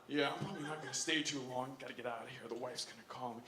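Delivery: low, soft, reserved mumble of excuses; uptight. Retold or imitated. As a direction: make quiet excuses, keeping it low. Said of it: "he's kind of uptight".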